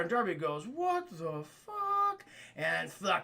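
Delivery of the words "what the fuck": very slow, drawn-out higher disbelief; stunned. Acted. Darby realizing the cop's trick, slow stunned disbelief. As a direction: stretch it out in disbelief.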